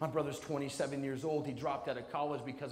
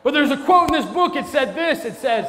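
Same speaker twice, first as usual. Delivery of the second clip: loud and high, breaking into laughter; laughing shout; 9 semitones higher, 15 dB louder than usual. Real, not acted. Speaker to students, still laughing loudly. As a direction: push through the laughter loudly. Laughing fit before the quote.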